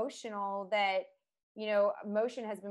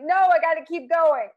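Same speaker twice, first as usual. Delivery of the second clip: retold tearful insistence; 8 semitones higher, 11 dB louder than usual. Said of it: "I cried my way through it".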